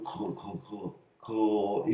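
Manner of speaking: 그 said slowly and drawn out, tongue far back; a slow, drawn-out soft 그 from the back of the mouth; velar demo. Shown as a demonstration. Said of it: "더 뒤로 가서 소리가 나고".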